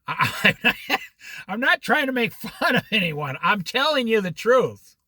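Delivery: ordinary talk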